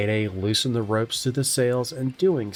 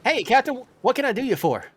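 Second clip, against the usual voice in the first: higher, brighter sailor voice; eager crewman; 11 semitones higher, faster than usual. Acted. Healer answering the captain's call. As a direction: rush in ready to help. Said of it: "Banda comes rushing up".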